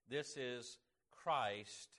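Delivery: "This is Christ" spoken slow, every word weighted; slow stress on Christ. Shown as a demonstration. Preacher quoting the angel to shepherds, slow emphasis on "Christ". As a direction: lay each word down slowly, landing on "Christ". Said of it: "I'm gonna be emphasizing the word Christ".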